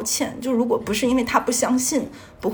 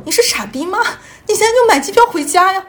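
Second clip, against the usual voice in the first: retold exasperated shout, higher and louder; imitating friends yelling; 9 semitones higher, 6 dB louder than usual. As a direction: bark it at a panicking friend like she's daft. Friends scolding her on the phone. (模仿朋友着急地大声吼)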